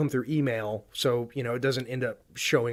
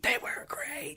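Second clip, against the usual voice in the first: forced stage whisper, much softer; whispered echo; 9 dB quieter, slower than usual. Pretend. Co-host mock-praising the listener's suggestions, whispered echo.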